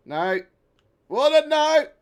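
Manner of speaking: loud, high cry of no; desperate shout. Real, not acted. Shouting as his Warden ship gets flung away by physics. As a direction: plead with the ship, yelling.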